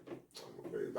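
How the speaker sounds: whispered to herself. Real, not acted. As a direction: whisper it softly. Whispered repeat.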